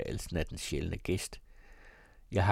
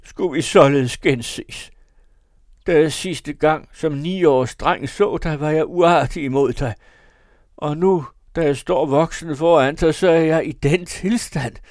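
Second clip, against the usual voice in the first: loud, high-pitched tearful lament; wailing; 7 semitones higher, 12 dB louder than usual. A narrator's reading. Drunk son addressing his mother's portrait, wailing. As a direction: pour out remorse at full voice. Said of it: "jamrede han".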